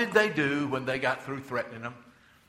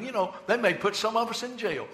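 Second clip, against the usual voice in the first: ordinary talk, nothing marked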